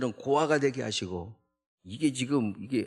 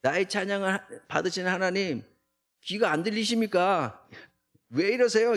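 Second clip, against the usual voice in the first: indignant protest; 6 semitones higher than usual. Shown as a demonstration. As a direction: demand God's attention, fed up. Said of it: "이렇게 읽는 게 아니고".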